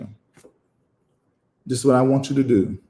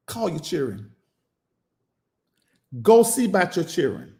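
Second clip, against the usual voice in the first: normal talking voice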